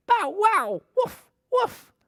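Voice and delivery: very high yappy puppy barking. A narrator's reading. The puppies bark at the farm animals, high yaps.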